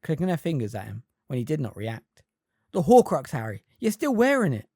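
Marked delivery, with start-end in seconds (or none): 2.7-4.7 impatient. A narrator's reading. Hermione urging impatiently.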